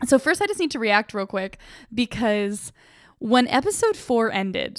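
ordinary delivery

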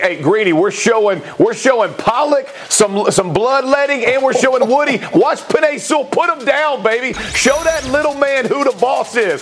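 pumped-up narration over pancake block film; hyped play-by-play. Acted. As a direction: call every block like a highlight.

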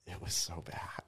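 near-whispered verdict; hushed, confiding aside; hushed emphasis. Real, not acted. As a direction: say it under your breath. Dwelling on an awful set.

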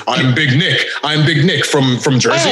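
low, half-hearted promo voice; deep, deflated, halting self-introduction; Big Nick. Retold or imitated. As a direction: imitate a wrestler's feeble Jersey character. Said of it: "in this exact voice".

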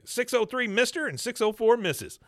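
high, loud eager blurt; eager Bobby. Retold or imitated. Bobby jumping in on the case, high and eager. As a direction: blurt the times out like a keen kid. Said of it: "he's like".

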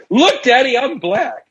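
put-on high, innocent child voice; a boy showing off to his dad. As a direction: announce it proudly like a little kid.

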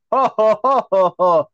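mocking, deliberate ha-ha-ha; moron's fake laugh. Imitating the moron next to you, forced laughing. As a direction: dumb forced laugh, spelled out. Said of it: "moron next to you go".

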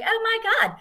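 light squeal of delight at the idea; mock gush. Bride reacting to the church ruins photo, mock excited.

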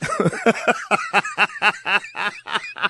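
short real laugh after the punchline. Real, not acted. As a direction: laugh it off, amused at yourself. Laughs at son being right.

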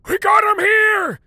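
put-on slow, high, loud old man's shout. A narrator's reading.

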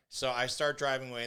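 normal talking voice

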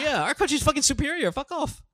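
high, mock superior retort; cocky put-on dismissal; unbothered Australians. Host voices Australians shrugging off mockery, mock dismissive. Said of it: "Fucking Australians don't give a fuck".

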